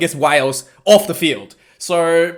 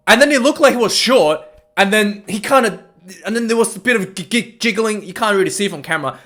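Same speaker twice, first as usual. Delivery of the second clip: ordinary talk, nothing marked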